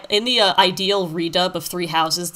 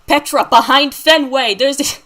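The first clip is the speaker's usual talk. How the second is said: put-on Boston accent; 6 semitones higher, 7 dB louder than usual. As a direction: yell orders in a thick Boston accent. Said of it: "Three Houses Boston A U".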